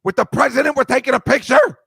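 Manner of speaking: mock outraged father scolding his son; angry dad. Imagined dad scolding son over the photo, exasperated. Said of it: "the dad would be like".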